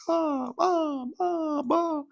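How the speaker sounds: put-on very high-pitched baby coos; imitated baby cooing. Baby cooing away on a parent's lap, imitated coo. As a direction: coo like a contented infant. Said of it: "he just starts cooing".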